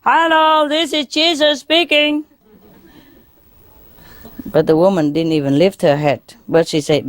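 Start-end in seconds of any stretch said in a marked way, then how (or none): 0.0-2.2 very high, strained pretend yell; high sustained holler without real volume; mock full-power shout. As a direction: fake a shout at the top of your range. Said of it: "he shouted with all his power".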